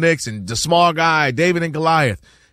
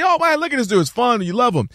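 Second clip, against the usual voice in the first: higher-pitched imitated fan delight; admiring fan; 6 semitones higher than usual. Fans watching young Anakin learn, admiring and higher-pitched. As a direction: gush like an onlooker won over. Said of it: "and, and you see".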